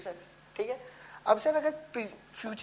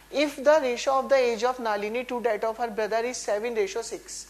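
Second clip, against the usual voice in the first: reading the question; 4 dB louder, much slower than usual. Shown as a demonstration.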